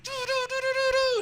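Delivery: very high sung doo's mimicking race cars passing; imitated cars zooming by. Announcer mimics cars flying past the booth, high singsong doo's.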